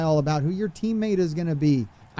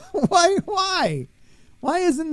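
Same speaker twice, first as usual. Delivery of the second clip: much higher, laughing through a pained why; laughing disbelief; 11 semitones higher, slower than usual. Real, not acted. Streamer laughing in despair at the new shirt. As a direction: laugh helplessly at how bad it is.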